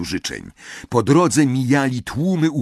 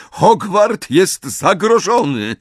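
higher, louder, slow proclamation; calling out; 9 semitones higher, 5 dB louder, slower than usual. Acted. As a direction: call out to the castle. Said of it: "zawołała profesor McGonagall".

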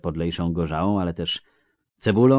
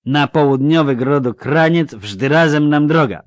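loud, higher, slurring tipsy villager; drunk Melfi; 8 semitones higher, 13 dB louder than usual. Acted. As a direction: call out like a cheery drunk. (pijany Melfi, głośno i bełkotliwie)

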